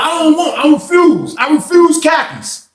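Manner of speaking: stubborn refusal. Retold or imitated. Inmate refusing khakis, stubborn.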